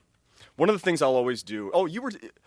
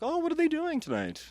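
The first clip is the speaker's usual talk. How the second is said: high mock voice. Imagined casual question to wife.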